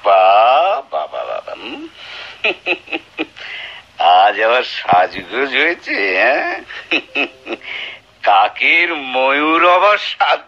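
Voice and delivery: drawn-out sneering "bah, bah"; mock her with slow scornful praise; scornful slow applause in words; sarcastic mockery. Husband mocks wife's dressing up.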